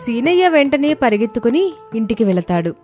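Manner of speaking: plain reading voice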